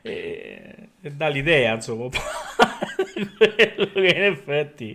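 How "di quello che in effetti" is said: words breaking into laughter; laughing. Real, not acted. Amused by the verse, laughing while explaining. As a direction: laugh through the phrase.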